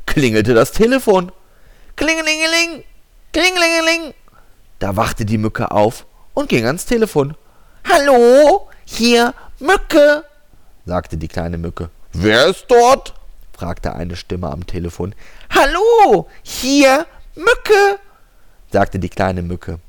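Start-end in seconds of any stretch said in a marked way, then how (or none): 2.0-4.1 high sing-song ringing phone; phone ring imitation. 7.9-10.2 high, loud squeaky mosquito; tiny mosquito voice. Acted. Mosquito answers phone. 12.1-13.1 over a landline phone. Acted. 15.5-18.0 high, loud squeaky mosquito; tiny mosquito voice. Acted. Mosquito answers again.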